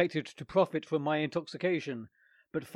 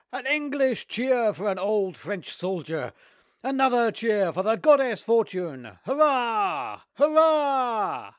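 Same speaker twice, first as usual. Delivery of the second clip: high, loud toasting cheers; rousing shout of hurrah; cheering toast; 8 semitones higher, 7 dB louder than usual. Acted. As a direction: raise a glass and cheer.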